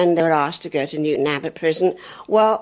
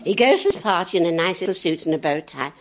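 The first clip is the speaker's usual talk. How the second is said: ordinary talk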